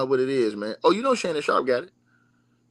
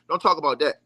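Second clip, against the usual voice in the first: ordinary talk, nothing marked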